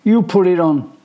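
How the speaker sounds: high, loud stubborn retort; defiant refusal. A narrator's reading. Michael refuses his brother, loud and defiant. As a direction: refuse him flatly.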